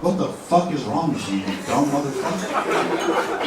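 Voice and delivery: inner voice. Retold or imitated. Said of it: "all I could think to myself was".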